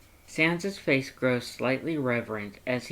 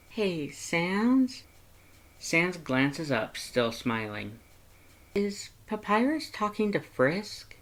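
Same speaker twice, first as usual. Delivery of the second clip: higher, lighter heroine voice; curious young woman; 5 semitones higher, slower than usual. Acted. Heroine asking Sans about Papyrus's call, higher and curious. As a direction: ask Sans gently, curious.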